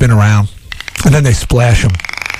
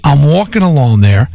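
usual speaking voice

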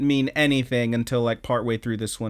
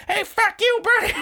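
cussing out. Retold or imitated. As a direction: screech it at the clerk. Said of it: "She just cusses him out".

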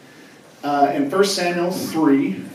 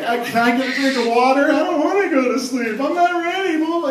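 put-on much higher, whining child stalling bedtime; whiny kid; 10 semitones higher, 4 dB louder than usual. Child begging parent to stay up, high whining. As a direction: whine like a kid who won't sleep. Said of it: "the kid that continues like".

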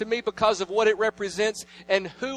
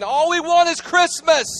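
put-on much higher, louder, childlike plea; children's cry; 10 semitones higher, 9 dB louder than usual. Children running in the school, high and loud. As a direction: cry it out like scared kids. Kids running around saying.

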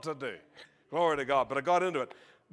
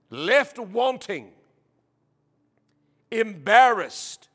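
loud, slow, raised preaching voice; scripture reading; 5 semitones higher, 7 dB louder, slower than usual. Acted. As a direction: proclaim it phrase by phrase with weighty pauses. Reads scripture aloud to congregation.

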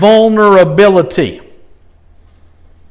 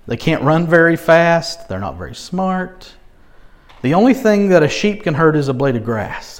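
usual speaking voice

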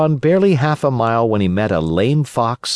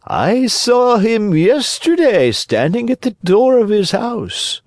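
sly fox; 13 semitones higher, 4 dB louder than usual. A narrator's reading. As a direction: stretch the words out high and slyly. Fox's voice in the story.

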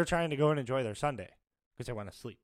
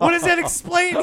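high, loud baffled question; a mock baffled shriek; 9 dB louder than usual. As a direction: push the pitch up in disbelief.

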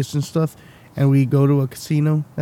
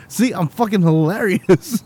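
ordinary talk, nothing marked

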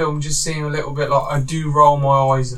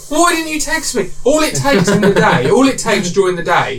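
mock outrage; 5 semitones higher than usual. Mock outrage at not invited.

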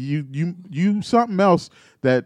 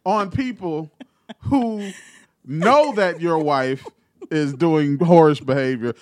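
mock scolding; 5 semitones higher than usual. Real, not acted. Scolding the husband.

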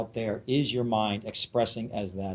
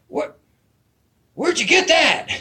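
high, loud mock-amazed hippie; squawked old-hippie impression; Mickey; 14 semitones higher, 6 dB louder than usual. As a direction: imitate an astonished old hippie, voice shooting way up. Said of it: "this is the way he talks".

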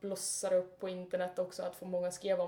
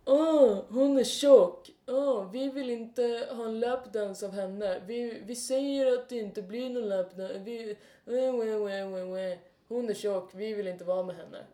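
mock whiny bro; 5 dB louder, slower than usual. Mocking the boys about [name].

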